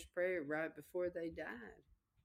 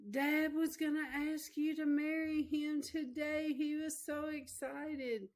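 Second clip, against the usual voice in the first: a hint of eager children, higher; small children breaking the news, higher; lightly voiced kids; 9 semitones higher than usual. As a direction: voice the little kids blurting it out. Children tell her news.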